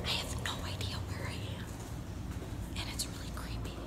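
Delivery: hushed whisper in an echoey room. Real, not acted. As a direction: whisper so it won't echo. Exploring a creepy echoey place.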